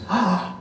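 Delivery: sharp high gasp of amazement; startled gasp. Retold or imitated. Son gasps at the giant photo, sharp gasp. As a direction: suck in air in wonder. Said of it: "David looks and goes".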